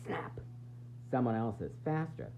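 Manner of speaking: usual speaking voice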